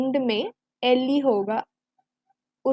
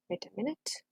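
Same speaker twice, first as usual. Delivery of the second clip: quiet aside; 4 semitones lower, 16 dB quieter, much slower than usual. Real, not acted. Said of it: "aside to herself".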